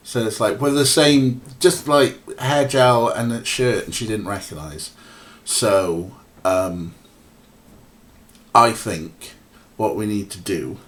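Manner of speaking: ordinary talk, nothing marked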